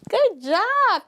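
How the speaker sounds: very high, bright good job; excited praise. Real, not acted. Teacher cheering the class, very high. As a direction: cheer them on.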